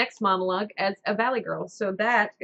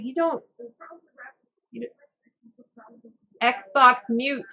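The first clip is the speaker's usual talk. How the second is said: ordinary talk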